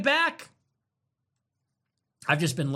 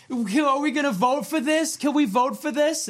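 higher, naive mock voice of eager voters; mocking imitation; 6 semitones higher than usual. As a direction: ape the clueless crowd asking to vote. And people go.

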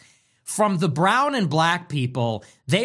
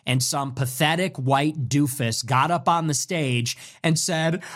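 usual speaking voice

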